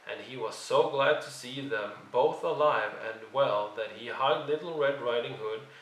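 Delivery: plain reading voice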